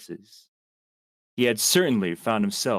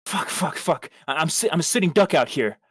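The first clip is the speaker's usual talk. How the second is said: panicky muttering; 7 semitones higher, 3 dB louder than usual. Acted. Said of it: "he thought bitterly".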